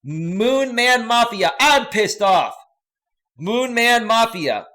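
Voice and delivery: loud, high, drawn-out announcer call; hyped read-out. Retold or imitated. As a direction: stretch out the username like a ring announcement. Mock-hyped read-out of chat comments.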